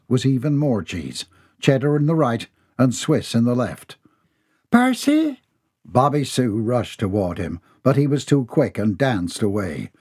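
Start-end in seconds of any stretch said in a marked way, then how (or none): 4.7-5.4 a startled mother calling her son; high shocked mother's call; alarmed mother. A narrator's reading. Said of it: "mother startled at son".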